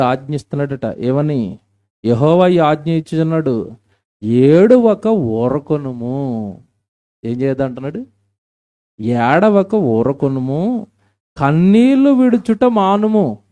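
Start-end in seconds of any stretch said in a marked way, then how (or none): 2.0-6.6 slow, loud, drawn-out proclamation; solemn scripture reading. Acted. Preacher proclaiming the verse to the girls, slow and loud. Pastor reads God's command aloud. 9.0-13.4 slow, loud and much higher; fervent scripture proclamation. Acted. Preacher urging weeping girls, slow and loud. Verse repeated with force.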